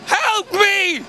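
high, hammed-up calling out; mock cry for help. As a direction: plead theatrically to passers-by. Mock plea to passers-by.

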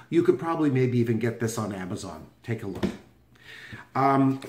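ordinary talk

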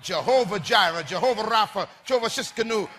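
ordinary talk, nothing marked

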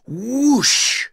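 usual speaking voice

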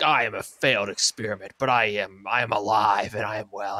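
low, measured Mewtwo impression. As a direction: speak like a grave clone announcing itself. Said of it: "the Mewtwo one was like".

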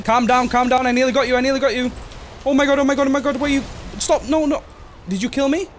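high, loud frantic panic; panicked. Real, not acted. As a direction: beg in a blind panic. Boss about to kill him.

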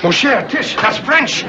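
husband aroused by his wife's French; thrilled by French. Acted. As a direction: swoon at the word. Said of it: "What French does to me".

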